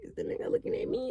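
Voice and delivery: breathy whispered aside to herself; a suspicious whisper under the breath; whispered suspicion. Retold or imitated. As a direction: whisper it, unsure who he's staring at. Private thought, suspicious whisper.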